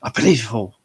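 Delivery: brief loud put-on celebrity voice; Mister T. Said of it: "like, Mister T".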